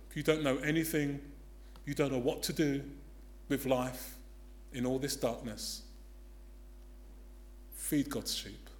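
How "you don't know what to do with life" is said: normal talking voice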